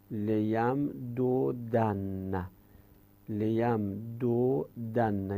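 "لیم دو دن" slowly split into lem, doo, danna; teacher slowly sounding out each part of "لیم دو دن"; syllable by syllable. Shown as a demonstration. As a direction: break "لیم دو دن" into three drawn-out pieces. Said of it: "یه دو داریم، یه دن نداریم".

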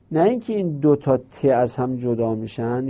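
ordinary talk, nothing marked

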